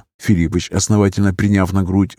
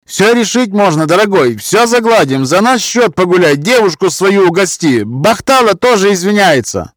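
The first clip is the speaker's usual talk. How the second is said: high, louder ingratiating voice; 11 semitones higher, 5 dB louder than usual. A narrator's reading. Peacemaker offering to pay, high eager pleading. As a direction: urgently talk your way out of it.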